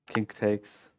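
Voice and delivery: ordinary talk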